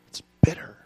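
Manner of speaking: whispered bitterness. Acted. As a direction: breathe the word out. Voicing Jeremiah's bitter lament.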